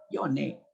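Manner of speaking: "Yone" with final i turned to e; Quechua-Spanish "Yone" for Yoni; "Yone" ending on e; Andean vowel confusion. Shown as a demonstration. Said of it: "Si eres Yoni".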